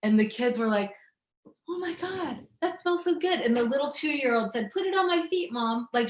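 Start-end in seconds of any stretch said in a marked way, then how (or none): 1.7-3.4 put-on high, delighted child voice; excited kids. Kids' delighted reaction voiced. 4.7-5.9 put-on high little toddler asking; two-year-old asking mom from the back seat.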